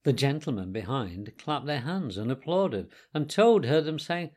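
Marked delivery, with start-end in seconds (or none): none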